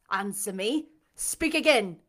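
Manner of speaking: loud order, building on the second command; imperious, aloud. A narrator's reading. Blind master ordering the unseen speaker, imperiously. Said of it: "imperiously and aloud".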